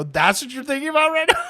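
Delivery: high, loud, laughing mock disbelief. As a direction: crack up at the absurd answer.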